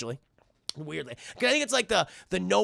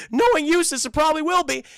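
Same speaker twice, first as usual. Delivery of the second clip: high, loud cartoon warning; worried Courage impression; 10 semitones higher, 8 dB louder than usual. Dog warning Bigfoot about the farmer, worried. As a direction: warn in a raised, comic voice.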